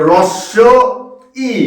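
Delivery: "রস্য", long pause, then "ই"; correct letter name. Shown as a demonstration. Teacher modelling vowels for students, "রস্য" and "ই" held apart. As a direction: say "রস্য ই" in two separate parts. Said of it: "এর উচ্চারণটা করবে কিন্তু".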